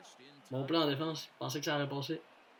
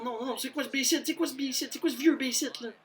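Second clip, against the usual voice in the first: indignant; 10 semitones higher, 4 dB louder than usual. Real, not acted. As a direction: spit out the complaint. Indignant at a play.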